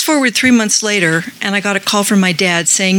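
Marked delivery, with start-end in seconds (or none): none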